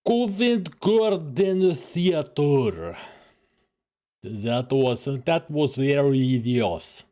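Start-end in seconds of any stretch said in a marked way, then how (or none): 0.1-3.0 slow mock Russian accent. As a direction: drag it out in a joking Russian accent. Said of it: "Loved your Russian accent".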